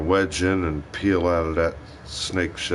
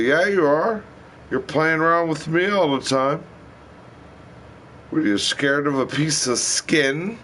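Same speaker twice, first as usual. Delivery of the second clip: raised, cocky mock threat; teasing taunt; 7 semitones higher than usual. Man taunting his angry partner, a raised cocky tease. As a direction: needle her, playing tough.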